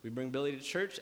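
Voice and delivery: ordinary talk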